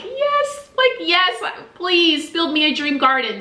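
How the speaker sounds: choked-up, high, overjoyed yes; teary yes. Real, not acted. Wife tearfully saying yes to husband's dream garden offer, high and emotional. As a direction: say yes while tearing up with joy. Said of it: "tearing up".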